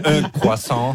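playful French pronunciation through a laugh; French accent. Retold or imitated. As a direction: imitate a French café order, amused. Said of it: "do it how you did it this morning".

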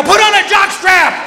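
high, slow, loud yell; shouting at the guide in a handkerchief; yelled retort. Retold or imitated. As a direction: bark it back at the guide. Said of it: "I go".

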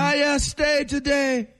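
put-on high squawky Jamaican-accented parrot. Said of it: "Did he have a Jamaican accent?".

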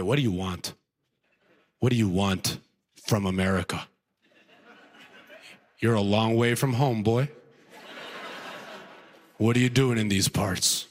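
low menacing interrogation; mock tough officer, low and menacing; gruff TSA officer.

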